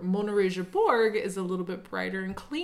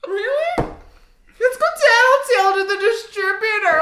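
higher, louder mocking jeer; mock a tattletale, much higher and louder; exasperated mockery; 11 semitones higher, 8 dB louder than usual. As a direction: taunt him, incredulous and loud.

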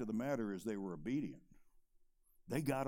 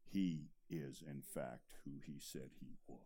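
low, soft, solemn close; hushed conviction; 11 semitones lower, 10 dB quieter than usual. Real, not acted. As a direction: let the truth settle quietly. Sermon climax, trailing down.

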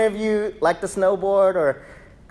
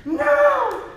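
high, loud, desperate cry after a lost friend; mock Cast Away wail; 5 dB louder, much slower than usual. Preacher mocks the congregation clinging to the past, high desperate wail. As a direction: wail "Wilson!" in mock despair. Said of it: "And we're sitting here".

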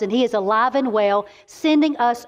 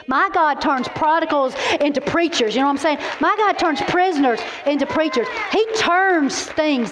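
preaching refrain; 4 semitones higher than usual. Real, not acted. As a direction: hammer the refrain home.

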